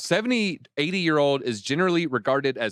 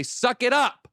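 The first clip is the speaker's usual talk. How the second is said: scolding; 11 semitones higher, 3 dB louder, slower than usual. Real, not acted. Scolding the fund founder.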